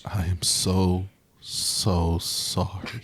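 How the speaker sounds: slow, stretched mock apology; hammed-up slow sorry; drawn-out apology. Real, not acted. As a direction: drag the so sorry out. Apologizing for monk topic.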